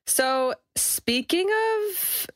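high, very slow, drawn-out tease; sashay into the topic; campy segue. Acted. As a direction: stretch it out like a diva. Campy segue into fashion talk.

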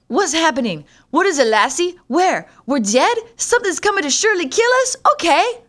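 high, loud, rushed panic to the dog; mock panic. Talking to the barking dog, loud mock terror. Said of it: "You're like".

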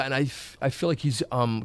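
normal talking voice

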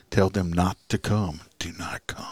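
quiet, breathy urgency, dropping to an unvoiced whisper; hushed plea. Wife whispering to husband to cancel the call. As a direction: hush him, call it off.

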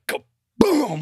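high punchy Kaboom as the door slams open; mock kaboom. Stallone smacking the hotel door open, mock blast. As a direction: sell the door blast, hammed up. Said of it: "he's like".

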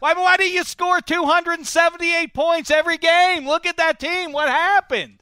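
high, loud, exasperated demand; mock outraged shout. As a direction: blow up in hammed-up disbelief. Mock outburst grilling guest over team.

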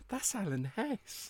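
high, soft, slow awe; hushed starstruck. Retold or imitated. Host recalling an elevator meeting with Alan Hess, hushed starstruck. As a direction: marvel under your breath at a celebrity.

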